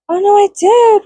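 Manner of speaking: high, loud dismay. Real, not acted. Discovering makeup left in husband's car, dismayed. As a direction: realise the loss with a wail.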